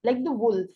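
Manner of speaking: "like the wolf" as a model, "wolf" weighted and falling; stress on WOLF. Shown as a demonstration. Teacher repeating "like the wolf" for practice, stress on wolf. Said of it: "wolf पर हम ज्यादा stress देंगे".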